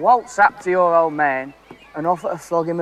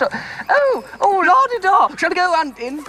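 posh toff voice; 12 semitones higher than usual. Pretend. Flash showing off his posh voice, hammed-up toff. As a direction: play the la-di-da aristocrat for laughs. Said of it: "I can do posh".

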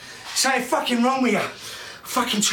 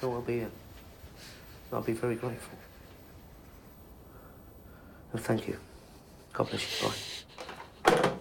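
low, softened voice, trailing away; hushed, low thanks and farewell; subdued phone goodbye; 11 semitones lower, 12 dB quieter than usual. Acted. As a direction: wind the call down quietly and politely. Father winding down a phone call.

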